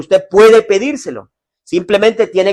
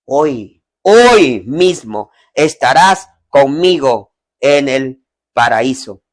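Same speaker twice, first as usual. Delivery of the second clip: slow tender promise; 4 semitones lower, much slower than usual. Acted. The Lord answering the dying thief, slow and tender. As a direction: promise it gently, word by word. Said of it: "la respuesta del Señor fue".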